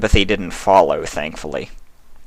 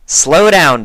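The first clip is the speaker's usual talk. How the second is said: firm call; 8 semitones higher, 7 dB louder than usual. Acted. Twilight halting Pinkie.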